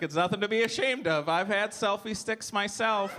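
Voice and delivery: high mom voice, slowing down. Acted. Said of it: "And she's like".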